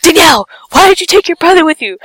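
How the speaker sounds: high, fast mock scolding; blaming mom. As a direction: scold like an upset mother. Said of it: "she blames me".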